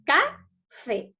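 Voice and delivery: "Café" split into ca and fé; syllable by syllable. Shown as a demonstration. Teacher counts syllables for pupils, "Café" syllable by syllable. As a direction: say "Café" as two separate syllables with a gap between. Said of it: "lo divido en sílabas".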